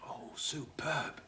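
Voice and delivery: a hushed, drawn-out superb; slow whispered praise. Real, not acted. Guest whispers slowly while tasting the presenter's soup. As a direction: breathe it out slowly, savouring.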